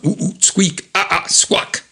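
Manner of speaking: high squeaks and squawks; squeaky nonsense imitation. Recalling which song it's from, high squeaky imitation. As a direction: imitate cartoon animal noises in high pitch. Repeats the squeaky gibberish from memory.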